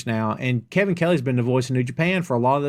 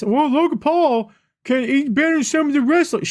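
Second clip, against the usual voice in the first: mocking fans; 11 semitones higher, 4 dB louder than usual. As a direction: mimic gushing fans in a silly high voice. Mocking Logan Paul's fans.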